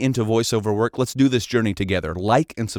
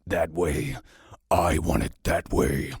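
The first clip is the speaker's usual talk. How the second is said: deep, slow dramatic echo; low, drawn-out trailer voice; dramatic voiceover reading; 5 semitones lower, slower than usual. Shown as a demonstration. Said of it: "dramatic voiceover reading".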